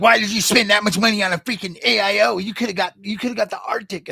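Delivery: lightly voiced nagging commenter; mock commenter voice. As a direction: sneer like a know-it-all in the comments. Mocking commenter on his AIO.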